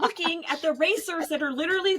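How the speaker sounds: ordinary talk, nothing marked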